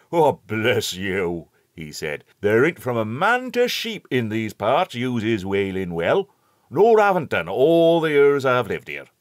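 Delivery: put-on a hearty old countryman calling out; old rustic shepherd. A narrator's reading. Shepherd explains the well.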